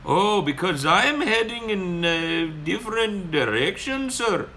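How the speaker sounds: high, slow excuse in a mock Indian accent. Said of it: "He's like".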